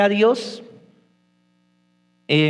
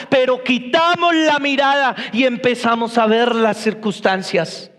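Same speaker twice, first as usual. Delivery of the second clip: higher-pitched, driving sermon delivery; impassioned preaching; 7 semitones higher than usual. Real, not acted. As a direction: preach it hard, piling up the points. Preaches at full force. (predicación enérgica y apasionada)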